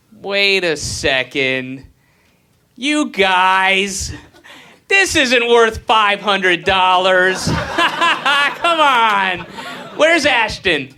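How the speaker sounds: high, loud indignant shout; mock outrage. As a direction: call out the rip-off, laughing. Mock outrage at restaurant prank.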